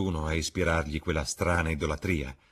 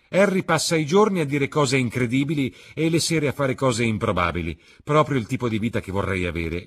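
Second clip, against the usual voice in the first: higher, louder youthful voice pressing his friend; young Dorian, eager; 6 semitones higher, 8 dB louder than usual. A narrator's reading. Dorian reassuring Basil, higher and louder. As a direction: Dorian brushing off talk of adoration, bright and insistent.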